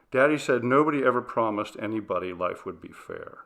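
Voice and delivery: plain reading voice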